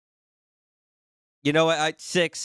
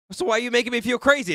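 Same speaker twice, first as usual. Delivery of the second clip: rushed, much higher and louder outburst; exasperated; 9 semitones higher, 5 dB louder, faster than usual. Real, not acted. Streamer vents at chat for confusing him, exasperated. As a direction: blurt it out in disbelief.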